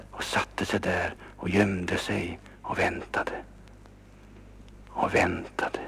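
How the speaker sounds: slow hushed narration; whisper. A narrator's reading. Narrator tells children the pair hid and waited, whispering. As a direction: whisper it like hiding in the dark. Hiding inside piano.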